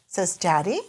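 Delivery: usual speaking voice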